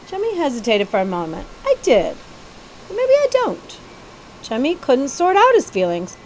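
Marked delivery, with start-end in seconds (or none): 2.9-3.8 a hint of a wavering young prince voice, pitched up; half-hopeful, lightly voiced prince; unsure prince.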